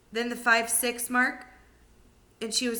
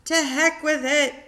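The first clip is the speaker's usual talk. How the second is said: put-on higher, louder reckless old woman; defiant shout; 6 semitones higher, 7 dB louder than usual. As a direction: bellow it, not caring a bit. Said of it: "she shouted".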